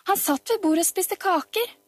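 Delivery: high, excited tattling voice; high-pitched eager exclamation; child calling out. A narrator's reading. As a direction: pipe up with outrageous news. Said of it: "ropte Peder".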